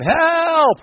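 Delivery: high mock yell for help. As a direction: cry out help from the canoe. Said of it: "the guy's yelling".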